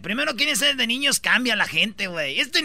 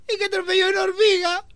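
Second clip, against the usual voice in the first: imitating a crying child; 10 semitones higher than usual.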